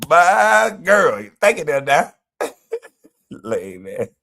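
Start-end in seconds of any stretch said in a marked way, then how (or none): 0.1-2.1 high, mock-delighted echo; happy imitation. Streamer echoing TJ's clip, high mock delight. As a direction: echo his glee in a raised voice. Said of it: "He was just happy to".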